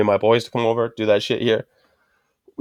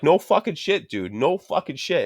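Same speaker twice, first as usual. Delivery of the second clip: worked-up retort pitched high; 5 semitones higher, 4 dB quieter, slower than usual. Retold or imitated. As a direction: retell an exasperated comeback, voice riding up. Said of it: "the guy can see I'm worked up".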